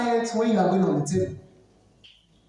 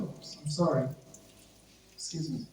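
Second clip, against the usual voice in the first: polite apology; 3 semitones lower, 9 dB quieter than usual. Shown as a demonstration.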